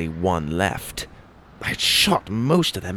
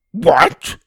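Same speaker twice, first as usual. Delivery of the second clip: sharp high startled "What?"; 10 semitones higher, 6 dB louder than usual. A narrator's reading. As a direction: jump up in alarm. Tim startled by sand threat.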